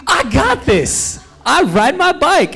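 high-pitched, drawn-out cocky boast; mock overconfidence. Cyclist thinking he's ready for spinning class, cocky and overconfident.

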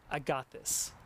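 calm "I got this" with no doubt; confident attitude. Shown as a demonstration. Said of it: "the idea of changing your city".

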